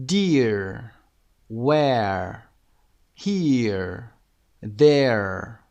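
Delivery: single words said slow and clear, each one stressed; model word list. Shown as a demonstration. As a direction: read each word out for repetition. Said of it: "listen and repeat the words and sounds".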